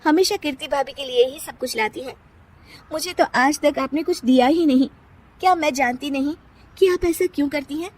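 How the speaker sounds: bitter daughter-in-law retort. Acted. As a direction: let out years of grievance. (सास को ताना देती बहू)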